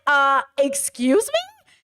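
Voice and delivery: slow, drawn-out incredulous question; exaggerated, affronted double-take; indignant. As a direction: stretch it out in mock disbelief. Own retold indignant reaction, second take.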